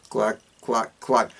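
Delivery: clack clack like a knock on the door; knocking sound. Retold or imitated. Narrator mimicking a knock at the door, clacking. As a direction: say it as a knuckle knocking on wood.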